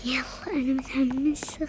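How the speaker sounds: excited whisper. Real, not acted. Girl sneaking, whispering her excitement. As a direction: hush your excitement.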